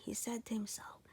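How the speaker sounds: ordinary delivery, nothing marked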